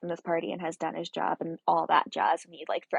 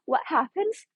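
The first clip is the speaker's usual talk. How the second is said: excited teasing question; 10 semitones higher than usual. Real, not acted. Fan teasing what comes next in the romance, excited question. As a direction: squeal the question to build suspense.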